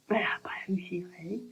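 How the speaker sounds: quick embarrassed whisper. Real, not acted. Telling viewers about the heroine, an embarrassed whisper. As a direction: drop to a whisper for the awkward bit.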